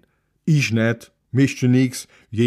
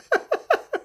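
kurzes hohes Auflachen über den eigenen Witz; hohes Lachen. Acted. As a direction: lach kurz und hoch auf. Man jokes about his book. (Mann lacht über seinen Witz)

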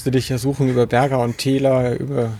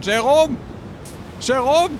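calling out; 11 semitones higher, slower than usual. Acted. Calling out across the water.

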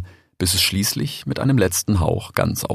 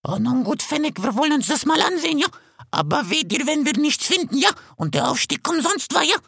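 much higher, rasping vulture voice; hoarse vulture. A narrator's reading. Vulture Bakit questioning the fox, hoarse. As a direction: croak it out like an old vulture.

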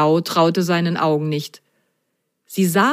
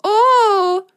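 very high loud delighted oh; very high enchanted cry; 5 dB louder than usual. Acted. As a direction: gasp with delight. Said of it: "rief sie ganz verzückt".

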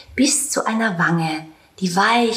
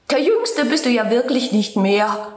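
higher, mocking giggly voice of the meadow; spiteful meadow; 6 semitones higher than usual. Meadow mocking the aging tomcat, higher and spiteful. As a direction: tease the old cat with malice. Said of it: "kicherte boshaft".

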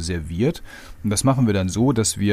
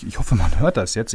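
normal talking voice

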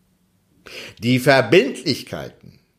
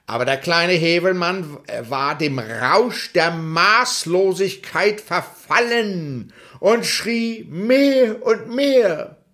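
ordinary delivery

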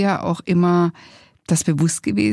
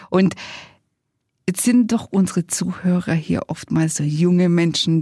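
ordinary talk

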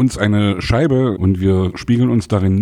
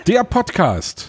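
announcer voice; 8 semitones higher, much slower than usual. Acted. Podcast intro jingle.

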